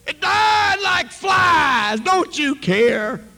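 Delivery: high, loud, slow outcry; slow anguished shout. Retold or imitated. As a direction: drag each word out, shouting at God. Preacher shouting at God.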